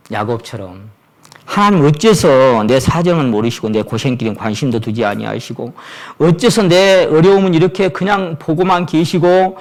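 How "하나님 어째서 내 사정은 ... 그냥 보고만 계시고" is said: building from a steady grumble to a loud, higher protest; Jacob's complaint. Retold or imitated. Jacob complaining to God, rising into a loud, higher protest. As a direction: let the grievance swell to a cry. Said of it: "막 이렇게 얘기한다".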